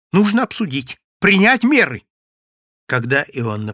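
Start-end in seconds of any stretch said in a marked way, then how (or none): 0.1-2.0 loud, high-pitched insistence; urging. A narrator's reading. Yevsey urging his friend to take measures, urgent. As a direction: press for action at once. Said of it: "торопил Евсей".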